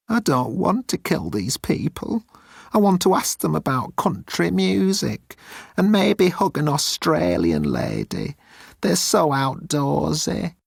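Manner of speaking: high, timid alien voice; high-pitched nervous alien; Moan's voice. Acted. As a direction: play Moan small and pleading.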